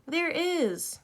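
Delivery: put-on high, slow little-girl voice from below; Denise's small voice. A narrator's reading. Trapped girl tells friends where she is, small high voice. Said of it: "said Denise's voice".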